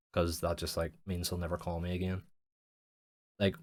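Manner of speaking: ordinary talk, nothing marked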